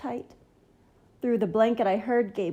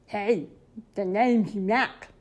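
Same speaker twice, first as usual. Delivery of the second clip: slow, lightly put-on monster drawl; a friendly scruffy monster says hello; monster Mac voice; 3 dB louder, slower than usual. Mac introduces himself.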